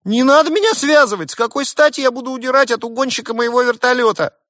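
very high, loud squeaky pilot; squawking outraged jackal; frantic indignant protest. A narrator's reading. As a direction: plead and object at the top of the range. Pilot protests being tied up.

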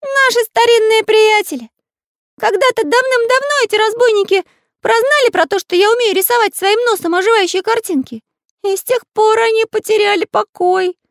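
very high, loud storybook character voice; high-pitched, emphatic little figure; voiced cartoon Pencil character, earnest. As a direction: pipe it up high and loud, speculating.

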